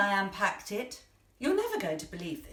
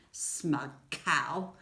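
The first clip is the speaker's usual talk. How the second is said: lower, drily contemptuous remark; disdainful aside; 5 semitones lower than usual. Acted. Woman on her friend who rang to boast, disdainful. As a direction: drop it low as a catty aside.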